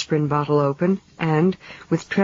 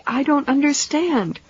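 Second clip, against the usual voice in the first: crying out; 10 semitones higher than usual. A narrator's reading. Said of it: "she cried".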